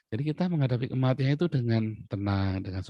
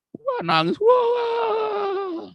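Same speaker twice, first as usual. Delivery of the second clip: very high, hammed-up wail; exaggerated mock sobbing; much slower than usual. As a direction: imitate the big brothers bawling. Brothers arrive weeping.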